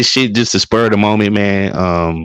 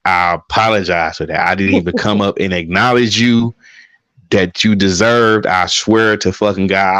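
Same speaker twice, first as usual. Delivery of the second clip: ordinary talk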